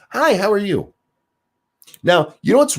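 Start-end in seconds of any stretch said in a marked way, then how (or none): 0.1-0.9 high-pitched mock chat-up hello; demo friendly greeting. Shown as a demonstration. Coach showing how to greet a woman, high demo hello. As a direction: pitch it way up, as an example opener. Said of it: "Go up there and be like".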